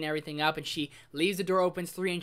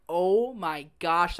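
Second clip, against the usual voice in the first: very slow, drawn-out disbelief; slow, awed gasp of words; stunned oh my gosh; much slower than usual. Retold or imitated. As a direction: let each word hang, overwhelmed. Crying at a sad scene.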